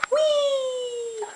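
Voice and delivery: put-on a delighted high "whee" for the baby; high playful whee. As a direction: squeal it way up high.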